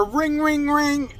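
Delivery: high-pitched mock ringtone; imitated phone ring. Phone rings in the middle of the fake call, imitated high ring. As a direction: mimic a phone going off. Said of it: "it's my phone fucking starts ringing".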